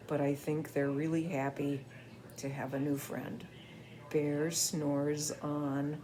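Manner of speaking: soft, tender ending; gentle. Acted. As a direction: wind down softly. Gentle close of story.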